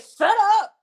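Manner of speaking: loud, high, mocking snap of shut up; a loud, mocked nasty retort; retold nasty shut up. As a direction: throw it back as her nasty put-down. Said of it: "she said it with her deep, nasty voice".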